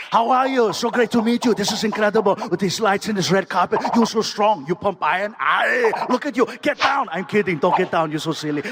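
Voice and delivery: put-on booming Austrian-accented star greeting fans; Arnold Schwarzenegger. Action star working a line of fans, effusive Austrian impression. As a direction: turn on the hearty Austrian celebrity act. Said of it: "and he turns it on".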